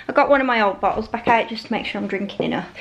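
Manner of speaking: normal talking voice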